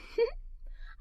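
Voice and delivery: airy little giggle; breathless giggle. Acted. Narrator giggling at the thought of growing up. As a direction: giggle softly under the stars.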